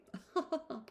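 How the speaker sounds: high, simpering little laugh; a coy, put-on titter; affected laugh. A narrator's reading. Miss Steele tittering at Eleanor, affected laugh. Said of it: "laughing affectedly".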